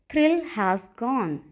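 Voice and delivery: very slow, each word stretched; slow drill. Shown as a demonstration. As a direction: say it slowly so students can repeat. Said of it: "तो कहिए".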